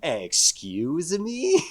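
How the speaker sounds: very slow, offended excuse me; drawn-out offended. Retold or imitated. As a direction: stretch it out in mock offense. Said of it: "Say that again?".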